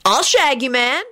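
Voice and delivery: high, hopeful dude voice; hopeful slacker proposition. A narrator's reading. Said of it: "he added hopefully".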